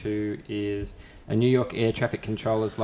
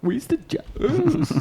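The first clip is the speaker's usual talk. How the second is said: much higher, very slow stretched-out catchphrase; Pauly Shore impression; much slower than usual. Echoing the Encino Man catchphrase, high and stretched. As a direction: drag it out like a stoned surfer.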